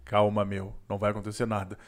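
mock soothing drag queen. Drag queen calming the panicked guy, low teasing calm. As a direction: tease him while calming.

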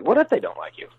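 high-pitched rhetorical challenge; challenging comeback. Acted. Voice actor answers scared beginners, high challenging comeback. Throws the worrier's question back.

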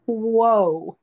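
loud astonished exhale of wonder; overwhelmed whoa. Real, not acted. Remembering her own forgiven sins, overwhelmed and astonished. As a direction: let it hit you all at once.

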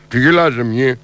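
mock stern cop; traffic cop again. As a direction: growl it as the cop. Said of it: "έρχεται ο τροχονόμος και λέει".

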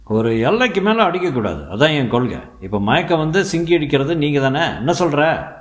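a high, loud swaggering thug; rough thug voice. A narrator's reading. Henchman boasting to his mates, in a high swaggering voice. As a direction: lord it over the others like a boastful henchman.

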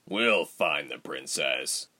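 low, soft, muffled through a door; a voice heard muffled from behind a door. Acted. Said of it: "a muffled voice said".

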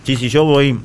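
talking through a mouthful of medialuna; mouth full. Real, not acted. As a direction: say it around a bite. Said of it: "Perdón que estoy con la boca llena".

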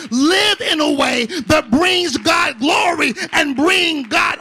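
loud, rising sermon preaching; preaching at full force. Acted.

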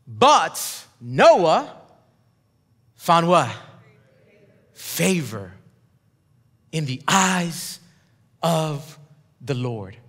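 low and slow, long pauses, fading to a hush; slow dramatic reveal. Real, not acted. Preacher slowly unveiling Noah's favor to the church. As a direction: let each word hang.

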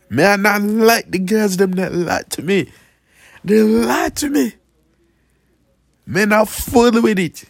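lightly put-on broken-English guy; a hint of a goofy pidgin persona; mock character voice.

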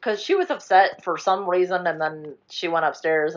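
normal talking voice

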